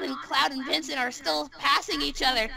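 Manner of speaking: ordinary talk, nothing marked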